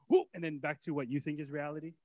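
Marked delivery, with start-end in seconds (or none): 0.1-0.3 quick whoop. Retold or imitated. Sudden glimpse sound.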